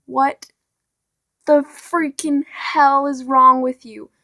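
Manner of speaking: ordinary talk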